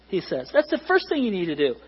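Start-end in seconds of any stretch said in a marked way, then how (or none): none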